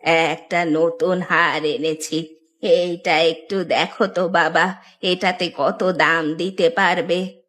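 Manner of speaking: put-on low, very slow old-woman voice. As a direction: drag the words out like an aged granny. Old woman selling a necklace. (বৃদ্ধার নিচু, ধীর গলা)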